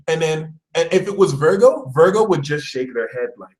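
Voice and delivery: ordinary delivery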